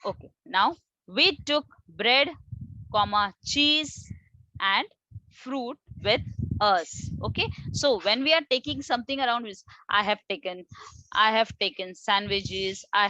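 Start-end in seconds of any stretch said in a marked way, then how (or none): none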